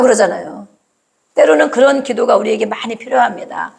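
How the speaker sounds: ordinary talk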